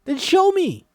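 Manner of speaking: high, loud insistent cry; a loud playful demand; demanding shout. Acted. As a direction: insist she show it. Ruby demanding Gertie show the sign.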